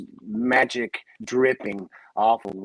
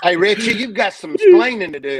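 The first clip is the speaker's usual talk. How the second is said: high, loud mock demand through laughter; mock scolding; 10 semitones higher, 8 dB louder than usual. Co-host teasing Richie about the film, mock scolding. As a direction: call him out in playful, laughing accusation. Teasing catchphrase after porn joke.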